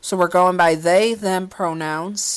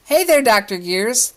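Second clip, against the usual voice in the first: higher, playful smiling greeting; 6 semitones higher, 5 dB louder than usual. A narrator's reading. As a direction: sing it out with a grin. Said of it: "they say with a smile".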